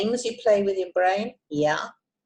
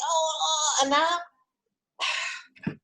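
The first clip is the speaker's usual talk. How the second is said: higher, strained fretting; mock-anxious gasps; acted tension before letting go; tense worry; 10 semitones higher, much slower than usual. Shown as a demonstration.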